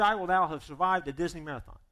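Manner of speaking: ordinary talk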